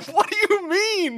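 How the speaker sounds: high, slow disbelieving squeak; incredulous what. Retold or imitated. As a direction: act stunned at the answer. Mocking reaction to dealer.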